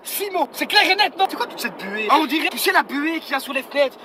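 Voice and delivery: normal talking voice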